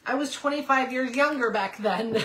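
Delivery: ordinary talk, nothing marked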